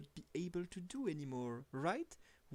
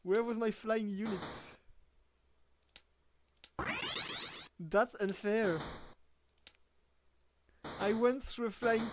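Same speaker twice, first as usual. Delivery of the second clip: laughing anger; 3 semitones higher, 4 dB louder than usual. Real, not acted. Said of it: "I'm actually quite angry".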